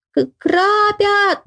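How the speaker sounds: high, long-drawn feeble cry of alarm; weak frightened cry. A narrator's reading. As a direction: stammer the start, then stretch it out high. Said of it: "закричала ещё тише и слабей".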